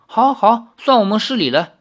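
high, lightly amused giving in; smiling concession. A narrator's reading. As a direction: concede with a smile, pitch lifted. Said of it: "李逍遥笑着说".

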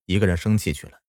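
plain reading voice

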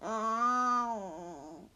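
a weary, hungry moan. Acted. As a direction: groan out the complaint. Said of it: "the pig moaned".

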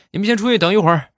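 high, fast, urgent; doctor hurrying patients out, fast and high; doctor shooing out. Retold or imitated. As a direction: rush them out the door.

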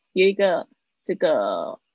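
ordinary talk

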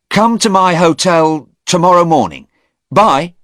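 loud, high-pitched brush-off; agitated parting. A narrator's reading. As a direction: call it out high and loud, then leave. Agitated Stephen hurriedly takes his leave.

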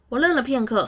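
ordinary delivery

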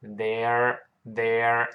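very slow "they're", vowel gliding into r; contraction demo. Shown as a demonstration. Teacher explains they are to students, slow drawn-out "they're". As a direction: draw "they're" out as one syllable. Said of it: "就they are".

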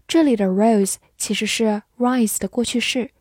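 ordinary delivery

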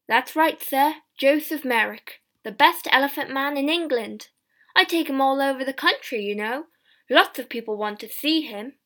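loud, lively showman's patter; eager showman talking up his act; boastful shopkeeper. A narrator's reading. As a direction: brag to the gentleman, loudly. Shopkeeper boasts about his exhibit.

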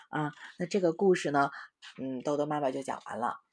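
plain reading voice

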